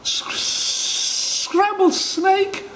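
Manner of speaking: high, slow, breathless horror; horrified gasp. Acted. Snake horrified by the food.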